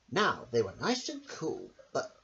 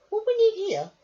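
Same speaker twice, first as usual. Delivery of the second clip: much higher, squeaky little lady voice; Missus Armitage voice; 9 dB louder than usual. Acted. Missus Armitage asks her dog a question, high and squeaky. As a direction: pipe it up like a fussy little lady. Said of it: "said Missus Armitage".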